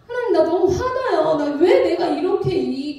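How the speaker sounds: hammed-up aggrieved appeal; retold angry prayer. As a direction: plead and protest to God, acted out. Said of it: "너무 화가 나는 거예요".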